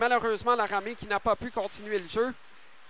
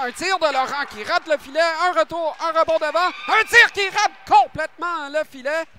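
excited; 5 semitones higher, 8 dB louder than usual. Real, not acted. Shot just misses the net.

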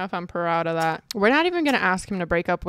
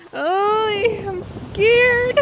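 very high, squeaky mock-fearful squeal; scared squeal; 12 semitones higher, 5 dB louder, much slower than usual. Real, not acted. Player squealing nervously as the guy arrives. Waiting for romantic moment.

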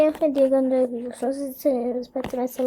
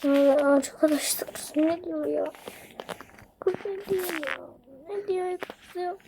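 hushed, much softer muttered confusion; whispered puzzlement; 7 dB quieter than usual. Real, not acted. Confused by a girl's trade message, hushed whisper. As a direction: whisper it so she won't hear.

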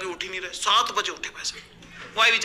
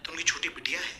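hushed aside; 3 semitones lower, 10 dB quieter than usual. Retold or imitated. Confiding gossip about the daughter.

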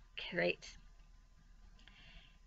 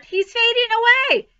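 high, loud wail of alarm; screamed alarm; 11 dB louder than usual. A narrator's reading. Girl seeing the pig fade, screaming. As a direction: cry out in panic at full volume. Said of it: "screamed Fern".

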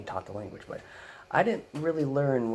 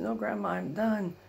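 grandson refusing; 10 semitones higher than usual. Retold or imitated. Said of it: "I said".

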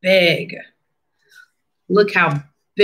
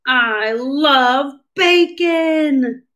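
slow, higher cheerful Dad; Dad's voice; 5 semitones higher, much slower than usual. Acted. Teacher voicing Dad craving bacon, slow and higher. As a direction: stretch it out like a happy dad. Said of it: "says Dad".

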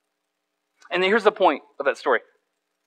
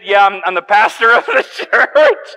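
laughing; 3 semitones higher, 6 dB louder, slower than usual. Retold or imitated. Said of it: "I said".

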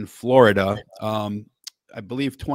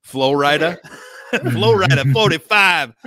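louder, higher hyped-up "Florida forty-five"; mock Florida announcer; 4 semitones higher, 8 dB louder than usual. Host calling a hype intro for the Florida guest, mock announcer. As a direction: bark it like a game announcer. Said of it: "I have to do".

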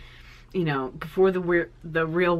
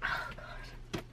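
tearful whisper; 13 dB quieter than usual. Real, not acted. Overcome by emotion.

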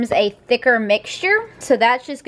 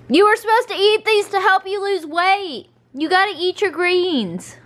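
higher, playful telling-off; mock scolding; 8 semitones higher than usual. Real, not acted. Owner playfully scolds the dog for spitting out his green beans.

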